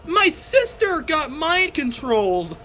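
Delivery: high, drawn-out dramatic announcement; gasp, then stretch out every word in amazement; mock shock. Announcing the sister got mind controlled, high dramatic shock.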